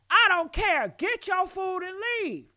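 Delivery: high, loud yelling as the aggressive man; retold angry yell. Host retells the man yelling at the woman in the restaurant, loud and angry. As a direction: bark it like a man losing his temper.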